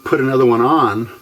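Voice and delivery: normal talking voice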